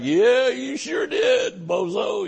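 slow, high mocking jab; drawn-out teasing put-down; sarcastic retort. Preacher mocking a hasty decision, slow and sarcastic.

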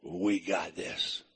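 a deep, easy reassurance from beside them; the Lord's voice. Retold or imitated. The Lord comforts the three in the furnace, low and soft. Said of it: "He said".

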